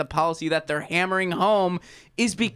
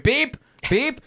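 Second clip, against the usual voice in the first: mock horn beep; 10 semitones higher than usual. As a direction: buzz them off with a mock horn. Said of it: "we're gonna beep this horn".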